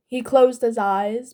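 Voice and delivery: ordinary delivery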